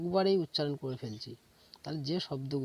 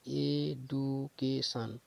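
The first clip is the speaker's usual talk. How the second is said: "Education" very slowly as এ, ডু, কে, শান; four separate, drawn-out beats in "education": এ - ডু - কে - শান; broken into syllables; much slower than usual. Shown as a demonstration. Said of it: "ভেঙে ভেঙে করা আছে". ("Education" ধীরে ভেঙে ভেঙে চার ভাগে বলা)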